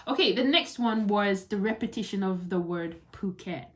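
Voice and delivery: usual speaking voice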